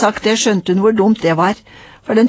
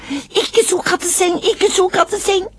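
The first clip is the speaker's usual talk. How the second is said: put-on toddler protest; 11 semitones higher, slower than usual.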